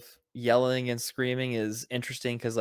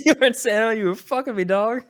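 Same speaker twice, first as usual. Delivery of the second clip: higher, louder, quick joking retort; mock comeback; 10 semitones higher, 7 dB louder than usual. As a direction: fire back a silly comeback fast.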